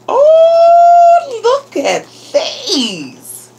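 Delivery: high, loud, drawn-out gushing; delighted squeal. Real, not acted. Vlogger seeing a friend's gift, delighted squeal. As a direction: squeal over the gift, stretching the words.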